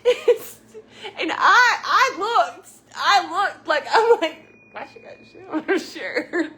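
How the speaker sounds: high, loud laughing talk near tears; real giggling fit; helpless laughter. Real, not acted. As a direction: laugh till you can barely speak. Cracking up at the memory.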